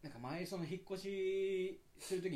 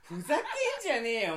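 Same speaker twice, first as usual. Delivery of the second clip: mock outrage; 8 semitones higher, 11 dB louder than usual.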